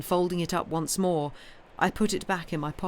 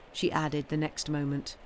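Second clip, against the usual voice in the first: plain reading voice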